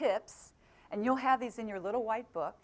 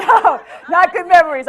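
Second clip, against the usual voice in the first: laughing protest, pitched high and loud; 7 semitones higher, 17 dB louder than usual. Real, not acted. Speaker answering audience joke about a sad movie, laughing. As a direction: laugh loudly through the words.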